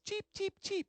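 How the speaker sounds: high little chirps; chirping cricket voice. Shown as a demonstration. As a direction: peep like a tiny bird. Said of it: "Can you go like this?".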